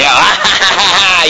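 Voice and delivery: imitated villain cackle; mock devil laugh. Preacher mocks people's idea of devil in hell, mock evil laugh.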